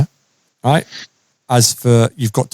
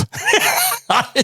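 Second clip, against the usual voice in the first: real high-pitched laugh; 3 dB quieter than usual. Real, not acted. Laughs at own story.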